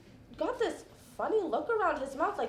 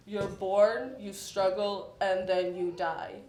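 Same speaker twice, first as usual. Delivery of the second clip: low, even mock-solemn pronouncement; imitating gloomy poet; 7 semitones lower than usual. Poet to the waitress bringing the check, mock-solemn. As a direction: drop the pitch for a lightly voiced brooding poet. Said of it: "he said to her".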